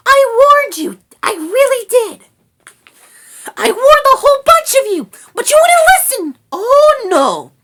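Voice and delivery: very high, loud scolding; agitated little rat, shouting; frantic rat. A narrator's reading. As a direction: wail the warning in a squeaky voice. Rat frantically says she warned them.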